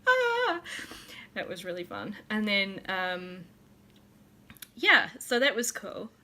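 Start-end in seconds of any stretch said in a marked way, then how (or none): 0.1-0.6 high, loud mock squeal; imitated excited squeal. Re-enacting vendor delighted by the doll, high excited squeal. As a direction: squeal like a thrilled fan.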